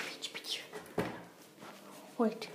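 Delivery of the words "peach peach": soft whisper of her name; whispered calling. Pretend. Searching for the missing Peach in a whisper.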